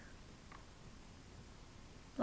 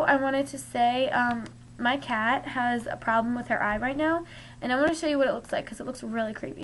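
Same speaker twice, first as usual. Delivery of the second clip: usual speaking voice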